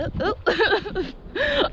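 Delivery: short high giggle; high laugh. Real, not acted. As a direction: laugh at your own slip. Laughs at own slip.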